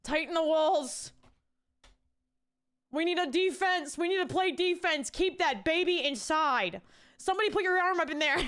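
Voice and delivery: rally the team like a coach; hammed-up battle cry; mock coach yell. Mock defense coach yelling.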